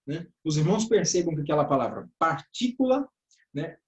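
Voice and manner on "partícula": "partícula" punched on TÍ, ending raised; demonstrative "partícula", strong TÍ and light "cula"; stress on TÍ. Shown as a demonstration. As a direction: bring out TÍ in "partícula" for the class. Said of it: "A sílaba tônica, é o T I".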